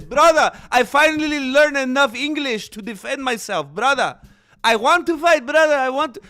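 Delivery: high, loud Dagestani fighter voice, leaning on every brother; hyped-up fighter begging for a fight, well above his own voice; Makhachev impression. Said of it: "He says".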